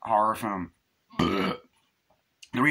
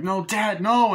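put-on high, louder frightened child's cry; mock scream; 10 semitones higher, 5 dB louder, slower than usual. Reviewer voices the daughter crying for her dad, high and loud. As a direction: wail it like a scared little girl.

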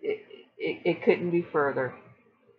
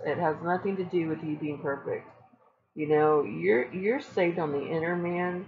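normal talking voice

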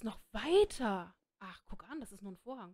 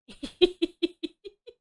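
loud laugh; 8 semitones higher, 9 dB louder than usual. Real, not acted. Streamer bursts out laughing.

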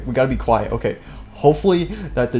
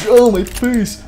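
high, loud yelp; mock pain cry; 8 semitones higher, 5 dB louder, much slower than usual. Player hit in the face, mock pained yelp. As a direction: howl as if hit in a game.

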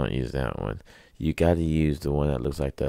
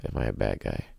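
low, soft voice of a good guy doubting himself; quiet self-questioning; 7 semitones lower, 7 dB quieter than usual. Retold or imitated. Joking that good guys doubt themselves, soft and low. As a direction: mutter it to yourself as a good guy would. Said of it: "Only a good guy would ask himself".

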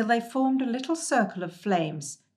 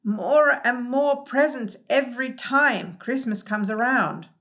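higher, louder, slow grumbling figure; fussy Hemulan complaint; 5 semitones higher, 5 dB louder than usual. Acted. Hemulan griping about endless presents, loud and slow.

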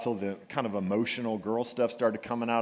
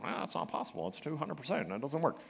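nerdy objection; 3 semitones higher, 9 dB quieter, faster than usual. Retold or imitated. Math nerds objecting.

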